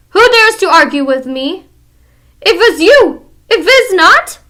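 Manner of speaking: high, very loud accusation; furious witch. Acted.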